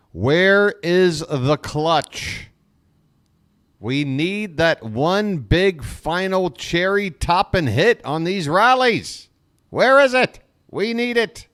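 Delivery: rising to a loud, high, exasperated plea; frustrated outcry. Real, not acted. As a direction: demand it like a fed-up fan begging his team. Frustrated fan pleading for hits.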